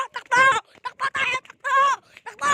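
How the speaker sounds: frantic yelling to run; panicked shout. Real, not acted. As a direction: scream at everyone to run.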